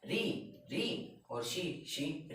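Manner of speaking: री and शी very slow and soft; slow syllables. Shown as a demonstration. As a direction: break ऋषि into syllables slowly. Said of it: "spelling ऋषि slowly". (धीरे-धीरे अक्षर)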